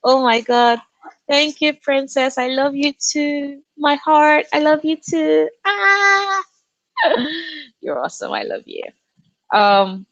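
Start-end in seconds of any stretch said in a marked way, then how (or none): 1.3-5.5 slow, high, melting thank you; touched and tender. Real, not acted. Touched reply to chat. 5.6-7.6 high squeal into giggle; delighted squeal and laugh. Real, not acted. Squeal at chat message. 7.8-8.8 breathy whisper, very soft. Real, not acted. Soft aside to chat.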